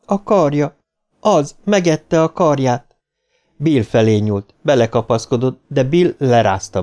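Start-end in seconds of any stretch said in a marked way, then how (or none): 0.1-3.0 high, slow distressed voice; slow sniffly horror; sniffling. A narrator's reading. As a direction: draw it out tearfully. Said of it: "szipogta Beverly".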